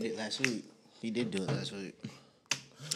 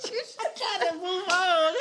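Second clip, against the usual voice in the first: high-pitched; 13 semitones higher, slower than usual. Real, not acted. Squeaky aside amid laughter.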